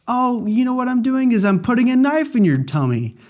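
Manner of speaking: high, cheeky put-on villain; sing-song it like a gleeful killer; playful high mock menace.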